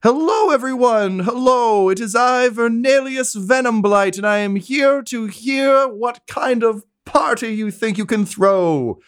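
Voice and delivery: very high, theatrical, drawn-out greeting; flamboyant aristocrat. Acted. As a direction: sweep in like a preening dandy. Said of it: "and, uh, says".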